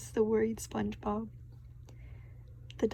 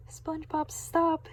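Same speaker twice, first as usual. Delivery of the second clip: high, sudden shout to stop; 6 semitones higher than usual. A narrator's reading. Mister Krabs stopping SpongeBob at the door, high shout. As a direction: call him off before he comes in. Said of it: "shouted Mister Krabs".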